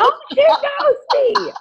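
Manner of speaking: high, drawn-out starstruck squeal; fangirl squeal. Acted. Fan hearing Oprah say their name live, hammed-up fangirl squeal. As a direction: gush slowly like an overwhelmed fan. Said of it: "fangirl moment".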